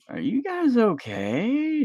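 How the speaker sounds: high, slow, drawn-out anxious check; worried parent. Retold or imitated. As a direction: stretch it out high like a nervous dad. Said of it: "you're gonna be like".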